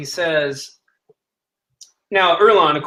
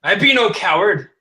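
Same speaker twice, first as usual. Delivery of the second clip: higher and louder, offended retort; outraged; 5 semitones higher, 4 dB louder than usual. Acted. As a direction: hurl the word back at him. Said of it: "he's pretty outraged".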